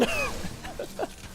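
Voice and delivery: airy unvoiced laugh; breathy high laugh. Real, not acted. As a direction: laugh at the blunder. Laughing after taunt.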